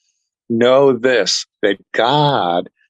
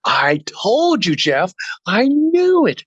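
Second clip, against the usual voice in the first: high, slow, gleeful I-told-you-so; excited told-you-so; 12 semitones higher, slower than usual. Retold or imitated. Wife crowing to doubting Jeff, high and excited. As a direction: crow with delight at being right.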